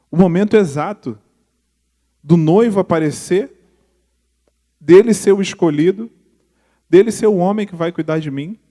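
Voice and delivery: loud, climbing sermon delivery, each phrase pushed; fervent preaching. Acted. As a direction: preach it out to the congregation, building. Preaching at full force. (pregação fervorosa e alta)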